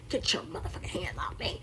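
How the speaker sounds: low menacing whisper of a screen tough guy; Denzel Washington. Retold or imitated. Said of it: "Like Denzel Washington".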